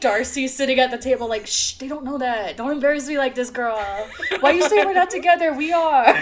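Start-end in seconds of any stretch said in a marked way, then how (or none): 1.5-6.1 mock Darcy. S1 voices Darcy at the table.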